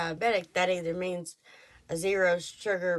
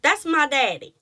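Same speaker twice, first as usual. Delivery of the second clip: defiant exclamation; 6 semitones higher, 8 dB louder than usual. Real, not acted. Defiant reply.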